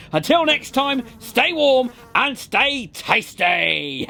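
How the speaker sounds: drawn-out catchphrase; slow sign-off. Real, not acted. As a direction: stretch it out slowly.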